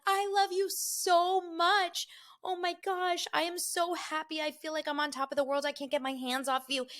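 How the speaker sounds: high-pitched lovestruck gushing; hammed-up swooning sweethearts; gushing couple. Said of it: "some of the couples are like".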